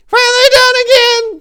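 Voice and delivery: put-on very high, loud old woman's shriek; shrill old woman. A narrator's reading. Old woman calling the priest, shrill and loud. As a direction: shriek his name down the phone. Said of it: "the old shrill voice of Pam Pickles".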